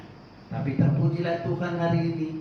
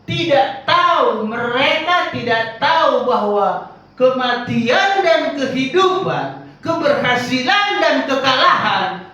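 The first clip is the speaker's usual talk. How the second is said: loud, slow, driving sermon peak; preaching at full force; 9 semitones higher, 10 dB louder, slower than usual. Acted. Preacher rousing the congregation, loud and slow. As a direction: hammer home each phrase to the congregation.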